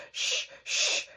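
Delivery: whispered shh; whispered shush. Acted. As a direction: hush the rooster in a whisper. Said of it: "he said to the rooster".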